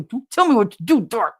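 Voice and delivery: mock-indignant outburst; mock bickering.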